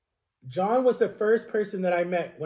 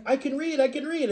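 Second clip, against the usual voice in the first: high-pitched, eager announcement; retelling a thrilled burst of news; excited; 9 semitones higher than usual. Retold or imitated. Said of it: "I was so excited".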